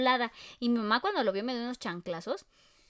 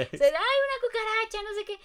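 high mock squeal; 9 semitones higher than usual. Retelling the boyfriend spotting the cockroach, high squeal. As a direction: imitate his squeaky cockroach alarm. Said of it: "todos empezaron a gritar".

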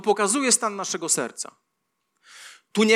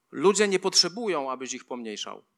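normal talking voice

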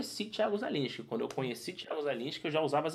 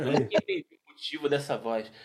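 laughing; 2 semitones higher, 3 dB louder than usual. Real, not acted. Laughing about the airport voice.

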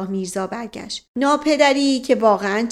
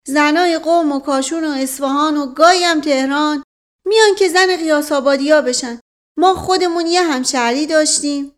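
a simple village man swearing he's honest, raised and eager; proud old servant from Qiasabad, higher and louder; rustic old servant voice; 5 semitones higher, 5 dB louder than usual. Voices Mash Qasem, rustic servant.

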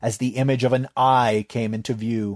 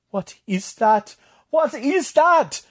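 slow, then much higher and louder on the repeat; panicked; 5 dB louder, slower than usual. Acted. Tatok staring at the cracking floor, panic building to a high cry.

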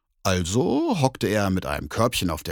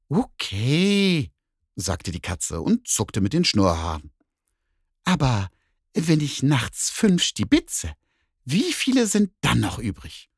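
higher, slow, sly cat voice; 4 semitones higher than usual. Acted. Cat asks the bear a sneaky sum, high and sly. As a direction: set a trick question.

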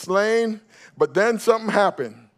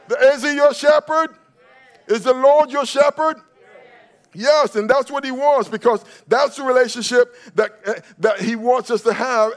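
loud, higher preaching to the congregation; full-force preaching; 5 semitones higher, 5 dB louder than usual. Acted. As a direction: whip up the church with rising fervor.

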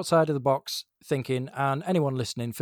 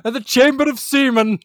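high, loud hammy proclamation; mock title announcement; 10 semitones higher, 10 dB louder than usual. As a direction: belt out the silly title. Hammy parody film title announcement.

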